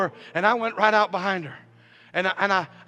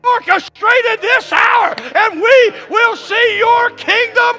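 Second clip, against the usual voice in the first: building from raised voice to slow, high shout; full-force preaching; 9 semitones higher, 11 dB louder than usual. Real, not acted. Preacher declaring God's calling, fervent building shout. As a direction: escalate each declaration into a roaring climax.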